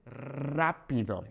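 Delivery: rápido with a rolled initial R, slow. Shown as a demonstration. Teacher shows students the rolled R in rápido, drawn out. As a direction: draw out a trilled R opening rápido. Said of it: "will be rolled".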